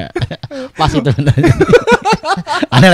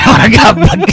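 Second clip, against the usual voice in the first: laughing; 10 semitones higher, 5 dB louder than usual. Real, not acted. Joking about a bored person.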